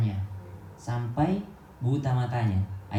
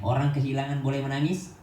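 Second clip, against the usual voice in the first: ordinary talk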